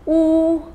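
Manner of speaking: "Wu" held high and level; first tone. Shown as a demonstration. Teacher practising syllables in four tones, "Wu" high and level. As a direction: keep "Wu" on one high, steady pitch. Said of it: "in each of the four".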